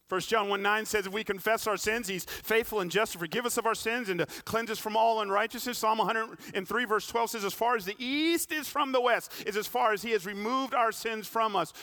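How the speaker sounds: loud, high preaching cadence; impassioned sermon delivery; full-force preaching. Acted. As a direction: preach it out, pressing the climax.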